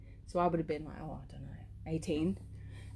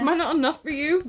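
laughing; 8 semitones higher, 5 dB louder than usual. Pretend. Mock jealous husband line.

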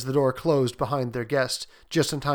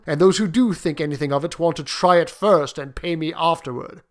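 firm, mostly louder soldier's voice; old soldier; 3 semitones higher, 5 dB louder than usual. Acted. Soldier explaining why he has not sold the paw, firmly. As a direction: speak up as a seasoned sergeant major.

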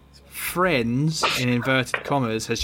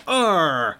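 startled grunt; 5 semitones higher, 5 dB louder than usual. Acted. GM voices character's grunt.

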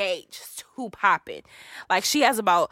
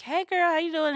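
bright greeting; 9 semitones higher than usual. Retold or imitated. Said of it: "I'm like".

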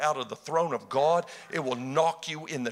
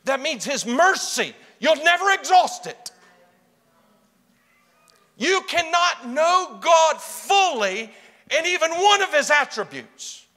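higher and louder, slowed emphasis; preaching climb; 9 semitones higher, 6 dB louder than usual. Real, not acted. Preacher exhorting the congregation, rising fervour. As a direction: press the point home.

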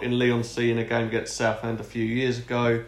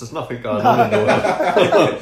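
laughing; 5 semitones higher, 7 dB louder than usual. Real, not acted. Joking about coronavirus, laughing.

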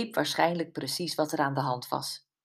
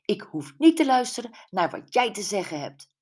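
fast, louder, higher cutting retort; angry retort; 5 semitones higher, 5 dB louder, faster than usual. A narrator's reading. Gwen furiously brushing off the woman, angry. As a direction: snap it out quickly, offended. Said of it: "draaide woedend haar hoofd".

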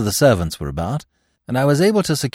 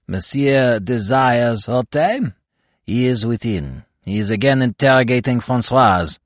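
official's voice; 3 semitones higher than usual. A narrator's reading. Attendant tells the visitor where Hautain is, politely.

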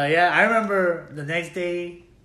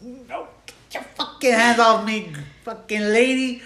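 louder, slower mock shout; a higher, louder, slower retold yell at the nurse; 7 semitones higher, 7 dB louder, slower than usual. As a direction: push her off with a hammed-up yell.

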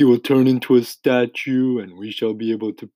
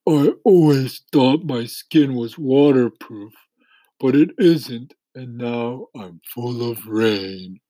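higher, plaintive moan slowing at the end; groaning earthworm; 3 semitones higher than usual. A narrator's reading. Earthworm lamenting waterlogged skin, groaning. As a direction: moan in misery, sinking to a slow finish. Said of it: "the earthworm groaned".

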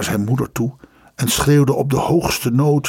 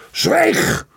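very high yell, much higher. Acted. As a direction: roar him into silence. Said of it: "riep zijn vader zo hard".

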